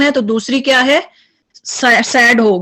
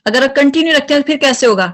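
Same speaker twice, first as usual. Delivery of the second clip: usual speaking voice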